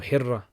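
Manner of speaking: "Hira" with a rolled, held r; doubled-r pronunciation. Shown as a demonstration. Streamer checking a viewer's suggested name, doubled-r pronunciation. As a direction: say "Hira" as HIR-ra, r trilled long.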